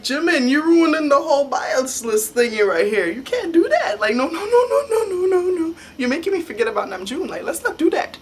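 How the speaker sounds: high, giggly fangirl pleading; mock protest. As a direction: protest in a high voice through laughter. Mock protest to [name], laughing.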